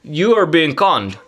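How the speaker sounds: put-on lightly done Russian accent; Vladimir Putin accent. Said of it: "That's a good Vladimir Putin accent".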